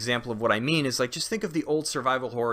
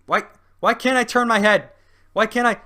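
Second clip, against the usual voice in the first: loud, high-pitched exasperated protest; hammed-up complaint from a stuck hero; Batman; 11 semitones higher, 10 dB louder than usual. Superhero unable to turn his head, mock exasperation. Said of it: "if Batman were a game and he'd be like".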